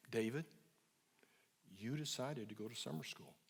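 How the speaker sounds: low, quiet, unhurried and calm; soft, low and slow. Retold or imitated. Therapist to angry teen son, soft and low. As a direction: say it gently and low, no pressure. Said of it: "I just kept saying soft, low, and slow".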